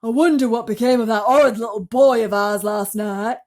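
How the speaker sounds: loud, sneering aunt voice; nasty Aunt Sponge. A narrator's reading. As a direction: sneer about the missing boy. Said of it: "Aunt Sponge said".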